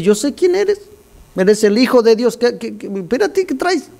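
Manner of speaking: a panicked demon recognising his master. Acted. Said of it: "Viene el demonio y le dice". (demonio asustado con voz muy aguda)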